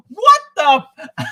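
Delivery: loud, high burst of disbelief; hammed-up retold shock; shocked outburst. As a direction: blurt it out, stunned, breaking into a laugh. His shocked reaction, retold.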